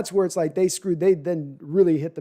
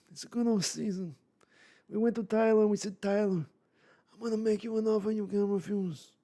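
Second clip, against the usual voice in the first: put-on Brando Godfather accent, softer and higher; mumbled Godfather-style mob boss accent; quiet Godfather mafia accent, Marlon Brando style; 5 semitones higher, 5 dB quieter than usual. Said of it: "trying to do there as an accent".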